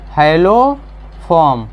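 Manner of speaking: very slow, stretched syllables; drawn-out key term. Real, not acted. Teacher answers own question with key term.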